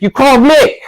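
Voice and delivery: high, loud indignant protest; worked up. Retold or imitated. Donna insisting he called her, all worked up. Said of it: "she gets all worked up".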